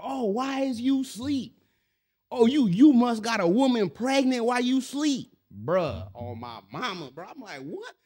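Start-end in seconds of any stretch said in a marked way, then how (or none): 0.0-5.4 high, loud, drawn-out accusation; mock high-pitched nagging; tripping girlfriend. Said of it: "wake up to her tripping". 7.6-7.9 very high, soft "what?"; acted-out bewilderment; confused. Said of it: "like, confused".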